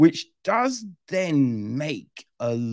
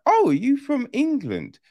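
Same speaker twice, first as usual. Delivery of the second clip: raised pitch, lightly voiced co-pilot; higher mock co-pilot; 10 semitones higher, 3 dB louder than usual. Imitating the co-pilot asking the hero, higher mock voice. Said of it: "the co-pilot's like".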